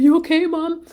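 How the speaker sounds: put-on high, loud child's voice asking; concerned young child voice; worried little kid. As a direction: sound like her small son checking on mom. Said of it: "my younger one was like".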